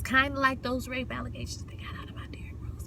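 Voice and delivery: hushed, gossipy whisper; whispered aside. Real, not acted. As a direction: slip it in under your breath. Sly gossip aside, lowered to whisper.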